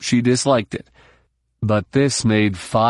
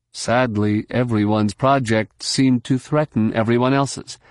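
ordinary delivery, nothing marked

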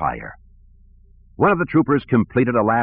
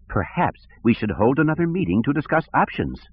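lightly voiced petty director, higher; peevish, a touch higher; whiny bureaucrat; 6 semitones higher than usual. Sivron voice.

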